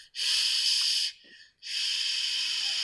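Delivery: "Sh" as a long rush of air; unvoiced SH sound. Shown as a demonstration. Teacher quizzing students on SH, sustained voiceless hush. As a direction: hold "sh" with no voice. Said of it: "Voice or unvoiced?".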